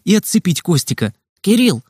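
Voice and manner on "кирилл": a mild call across the crowd. A narrator's reading. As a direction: call his name to turn him round. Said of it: "крикнул я". (окликнуть по имени)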